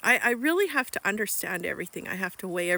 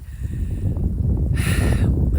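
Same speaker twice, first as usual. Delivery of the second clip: heavy sigh; 10 dB louder than usual. Real, not acted. Loud sigh before her message.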